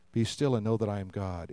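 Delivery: soft and low, almost murmured; hushed, still. Acted. Preacher quotes God calling for stillness, hushed. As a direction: quote it gently, with the voice dropped right down.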